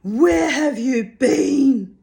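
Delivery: high, loud, slow scolding. A narrator's reading. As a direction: scold her like a stray cow. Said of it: "scolding her as though she were a strayed milk cow".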